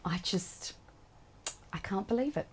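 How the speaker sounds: soft, near-whispered amazement; hushed disbelief. Real, not acted. Author holding her finished book for the first time, hushed disbelief. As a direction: barely voice it, overcome with wonder.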